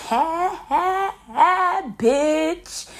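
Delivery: high beeping eh sounds; alarm imitation. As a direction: mimic the shop alarm. Store alarm going off.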